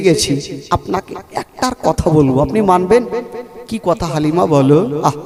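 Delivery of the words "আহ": ordinary talk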